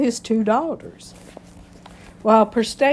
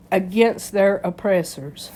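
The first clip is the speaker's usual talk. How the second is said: normal talking voice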